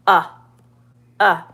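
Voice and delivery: "Uh" as a short, punched vowel, as in cup; stressed short U. Shown as a demonstration. Teacher showing the caret sound, stressed short U. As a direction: isolate "Uh" twice, stressed and brief. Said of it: "If it's the stressed".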